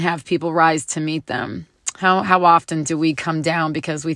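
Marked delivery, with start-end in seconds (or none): none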